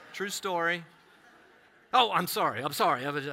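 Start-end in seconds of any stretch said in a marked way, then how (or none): 1.9-3.1 mock apology.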